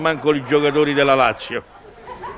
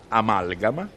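surprised question; 7 semitones lower, 5 dB quieter than usual. Real, not acted. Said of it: "Vedi che parola che mi è uscita fuori".

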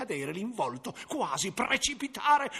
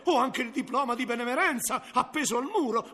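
hurried self-defence; 2 semitones higher, faster than usual. Acted. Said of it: "allora replicò".

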